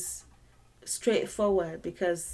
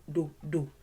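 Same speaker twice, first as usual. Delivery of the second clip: ordinary delivery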